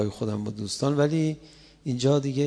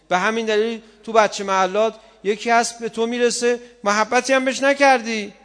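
high, loud, slow pulpit delivery; fiery preaching; 9 semitones higher, 7 dB louder, slower than usual. Acted. Preacher rousing the mourners, slow and loud. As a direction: drive each clause home to the congregation.

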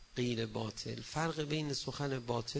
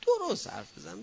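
higher and louder, cutting him off; sharp scolding; 7 semitones higher, 5 dB louder than usual. Shown as a demonstration. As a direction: snap it as a firm rebuke. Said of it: "ببین".

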